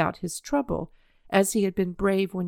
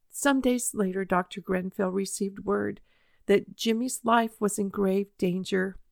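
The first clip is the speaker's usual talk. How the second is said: plain reading voice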